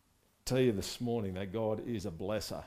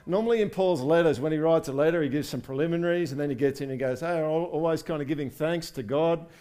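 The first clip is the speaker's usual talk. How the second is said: louder, rising fervent preaching; preaching at full force; 4 semitones higher, 6 dB louder than usual. Real, not acted. Pastor rallies his congregation, full fervent preaching. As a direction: lift the room, preach it out.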